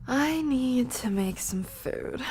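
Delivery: slow loud whisper; stage-whisper it slowly. Real, not acted.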